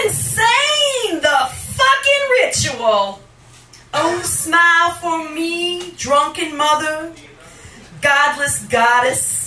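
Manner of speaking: loud, driving spoken word over music; spoken-word performance. Acted. Poet addressing the crowd, loud spoken word. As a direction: push the lines out like an incantation.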